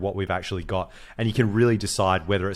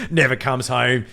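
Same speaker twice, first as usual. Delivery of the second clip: imitating dad; 3 semitones higher, 4 dB louder than usual. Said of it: "He's like".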